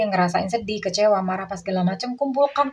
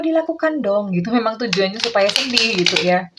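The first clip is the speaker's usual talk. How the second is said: ordinary talk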